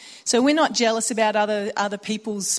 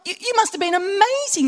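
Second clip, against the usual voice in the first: much higher, louder awed whoa; amazed; 10 semitones higher, 3 dB louder than usual. Retold or imitated. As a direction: gush with astonished admiration. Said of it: "I was just staggered".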